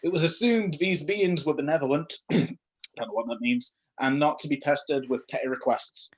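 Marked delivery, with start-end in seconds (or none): none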